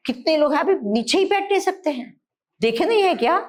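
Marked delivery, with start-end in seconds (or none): none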